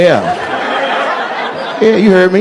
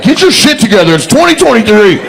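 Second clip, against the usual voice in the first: higher-pitched imitation of an exasperated woman; mock ultimatum, pitched up; fed-up scolding; 6 semitones higher than usual. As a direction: act out a partner who has had enough.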